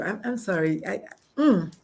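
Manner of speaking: ordinary talk, nothing marked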